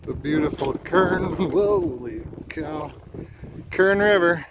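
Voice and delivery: excited. Real, not acted. Arriving at river after desert.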